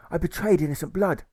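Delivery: shout. A narrator's reading. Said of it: "he shouted".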